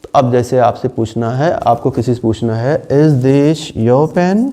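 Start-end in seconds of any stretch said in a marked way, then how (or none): 2.9-4.5 slow, rising Is this your pen?; slow model phrase. Shown as a demonstration. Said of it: "बोलिए". (धीमा, pen पर उठता सुर)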